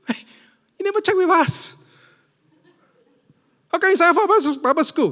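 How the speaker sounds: high, loud scolding of a grown son; angry parent tone. Retold or imitated. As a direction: snap at him like a shocked father. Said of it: "That was my tone".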